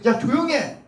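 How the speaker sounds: high-pitched crowd rebuke, acted out; scolding shout. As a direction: shout him down as the annoyed crowd. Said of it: "막 그에게 호통을 치는 겁니다". (군중처럼 높게 호통치기)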